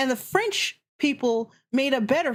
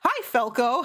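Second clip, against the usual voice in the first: ordinary talk, nothing marked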